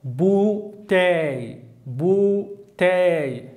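bouteille drawn out slowly with a falling end; teacher models bouteille slowly; French model word. Shown as a demonstration. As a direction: say bouteille twice, slow and falling. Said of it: "Şişe".

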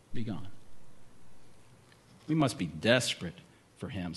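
ordinary talk

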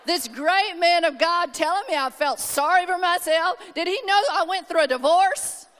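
hammed-up affront, building in pitch; mock indignant. Hurt woman reacting to being told she felt sorry for herself, mock indignant. Acted indignation at friend's rebuke.

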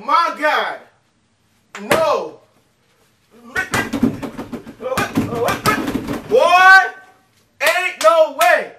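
high, loud disbelieving yells; shocked shouting. Real, not acted. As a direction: yell in total disbelief. Shocked reaction to the reveal.

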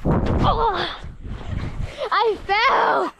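laughing as he falls; laughing shout. Real, not acted. Falls while filming, laughing.

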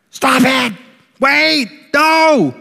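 a parent's half-mock panic shout; grumpy parent yell. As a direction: bark stop like a parent at the stove. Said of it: "gonna sound a little grumpy".

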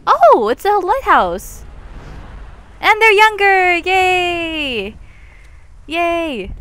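high, loud, delighted cheer; real excitement. Real, not acted. Player sees the lighthouse and younger characters, excited. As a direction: cheer it out, stretching the vowels.

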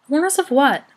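high, loud, exasperated question; impatient demand. A narrator's reading. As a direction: demand the answer at once. Brownie demands an answer impatiently.

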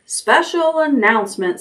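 lightly voiced pompous loudspeaker; pompous announcer. A narrator's reading. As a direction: announce it grandly. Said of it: "in a pompous voice".

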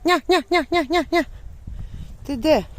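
high, loud call across the yard; calling the dog. Real, not acted. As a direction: call your dog from far away. Calling her lost dog outside. (голосно кличе собаку)

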